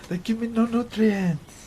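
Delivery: high put-on character voice; movie quote voice.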